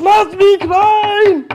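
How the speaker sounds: very high, slow yell to be let in; shouted plea. Pretend. Peter locked outside, yelling high and slow to be let in. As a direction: bang on the door and yell.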